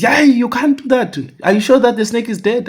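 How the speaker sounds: high, loud, fast shocked questioning; can't believe what he hears; alarmed disbelief. Retold or imitated. Uncle questions the boy about the snake in shock.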